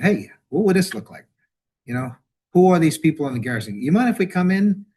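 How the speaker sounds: bold acted approach. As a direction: pitch up and call out as if approaching strangers.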